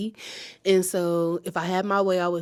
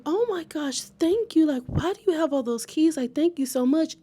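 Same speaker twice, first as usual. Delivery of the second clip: higher-pitched grateful gush; gushing thanks; 7 semitones higher than usual. Retold or imitated. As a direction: re-enact delighted, astonished gratitude. Thanking man who unlocked car.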